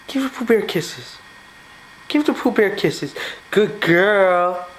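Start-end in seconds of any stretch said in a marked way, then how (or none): none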